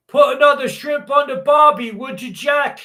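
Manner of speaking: high, hammed-up Aussie holler; Australian accent. Showing off the accent at the barbie, hammy Australian. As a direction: call it out in a broad mock-Australian accent. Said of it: "Australian accent".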